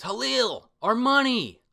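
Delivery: high, loud yell of a name; frantic call. Retold or imitated. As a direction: shout after his brother, desperate. Boy calls to his brother.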